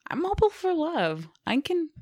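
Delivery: excited. Real, not acted. Gushing about hope.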